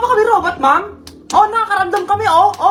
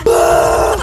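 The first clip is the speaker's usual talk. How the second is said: pained scream; 3 semitones higher, 6 dB louder than usual. Acted. Giving birth, pushing.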